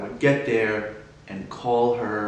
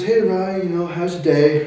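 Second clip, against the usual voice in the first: higher, louder cheerful greeting from mom; mom's greeting, pitched up; imitating mom; 7 semitones higher, 8 dB louder than usual. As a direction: lightly voice a mom greeting her son.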